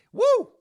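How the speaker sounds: high, loud whoop after the shot; excited whoop. Real, not acted. Hyped streamer whooping after a whiskey shot. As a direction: let out a big pumped-up woo.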